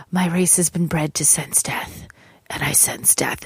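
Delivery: hushed, whispered alien proclamation; ominous alien. Retold or imitated. Podcaster quoting the trailer's alien, ominous whisper. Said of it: "alien who says something along the lines of".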